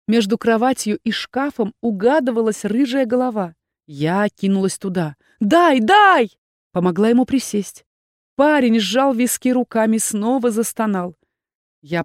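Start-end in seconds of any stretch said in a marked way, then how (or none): 5.4-6.4 urgent cry. A narrator's reading. Rushes to groaning boy.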